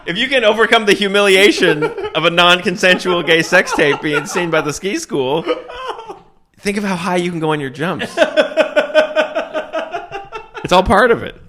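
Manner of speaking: high-pitched, talking through laughter; laughing. Real, not acted. Joking to co-host about the ski school, laughing hard. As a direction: struggle to get it out while cracking up.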